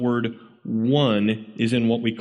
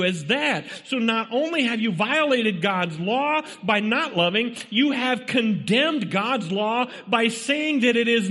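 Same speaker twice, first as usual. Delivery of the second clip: high-pitched, driving sermon delivery; urgent pulpit exhortation; impassioned preaching; 12 semitones higher than usual. Real, not acted. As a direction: preach it hard, pressing each point on the congregation. Preaches at full force.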